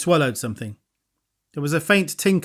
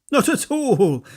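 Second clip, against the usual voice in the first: cheerful; 8 semitones higher, slower than usual. A narrator's reading. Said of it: "said Bones cheerfully".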